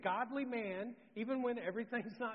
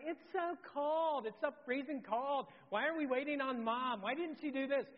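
put-on whining kids; 7 semitones higher, 3 dB louder than usual. Daughters complaining to dad at lift top, high whining. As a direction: whine it like a freezing child. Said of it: "They start whining".

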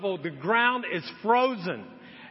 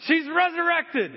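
high, louder amazed announcement; excited news; 9 semitones higher, 4 dB louder than usual. Retold or imitated. Friends on the phone declaring the hamster resurrected, amazed. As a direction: proclaim it like a miracle.

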